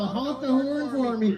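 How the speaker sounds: high, slow, gushing plea; flirty freedom mom. Retold or imitated. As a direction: swoon over the trucker.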